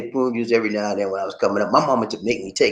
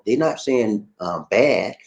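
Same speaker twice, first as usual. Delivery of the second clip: ordinary talk, nothing marked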